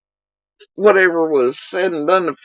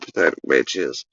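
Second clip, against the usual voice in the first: normal talking voice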